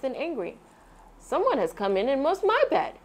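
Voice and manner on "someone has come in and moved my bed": surprised gasp. A narrator's reading. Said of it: "he gasped".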